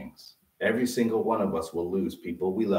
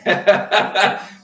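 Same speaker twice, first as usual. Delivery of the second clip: laughing; 10 semitones higher, 10 dB louder than usual. Real, not acted. Laughs about kids singing.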